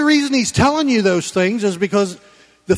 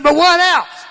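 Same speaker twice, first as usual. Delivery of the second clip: wife's demand, shouted high and loud; shouted, much higher imitation of his wife; mad wife's retort; 10 semitones higher, 5 dB louder than usual. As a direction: snap back like an irritated wife. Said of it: "She used to get mad".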